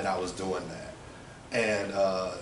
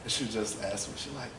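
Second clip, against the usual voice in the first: usual speaking voice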